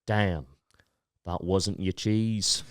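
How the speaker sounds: slow, low put-on accent; the performer's accent, soft and drawn out; deadpan showman in a put-on accent; Cheese Man accent. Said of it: "I gotta do his accent".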